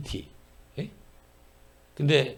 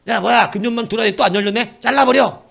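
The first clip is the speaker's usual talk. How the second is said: loud, higher angry order to cut it down; angry owner; 7 semitones higher, 7 dB louder than usual. Retold or imitated. Vineyard owner ordering the barren tree cut, loud and angry. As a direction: bark the order in exasperation.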